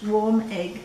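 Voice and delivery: plain reading voice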